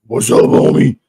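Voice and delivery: loud gruff trucker impression. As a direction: bark it like a trucker. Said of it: "She sounds like a trucker".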